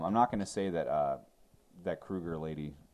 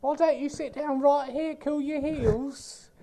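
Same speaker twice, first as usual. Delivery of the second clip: much higher, louder hammed-up British voice, slowing at the end; mock British accent; 7 dB louder, slower than usual. Mocking the movie's Brits with a high, loud British accent. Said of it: "they make fun of British people really bad".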